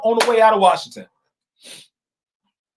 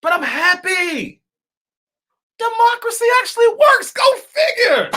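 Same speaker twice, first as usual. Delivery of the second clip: emotional joy; 11 semitones higher than usual. Real, not acted. Vlogger overcome after the election result, tearful shout. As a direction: let the joy break through, almost crying. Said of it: "got a flood of emotions".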